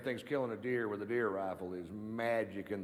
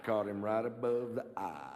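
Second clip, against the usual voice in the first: cousin Ray impression. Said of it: "my impression of my cousin Ray after the big kill".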